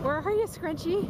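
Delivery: high call searching for the dog. Real, not acted. Looking for the dog.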